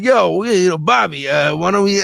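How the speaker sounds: a mock-chummy Tommy pitching his idea; imitating Tom Hanks. Tom Hanks casually pitching the director an idea, mock bro voice. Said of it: "so Tommy was like".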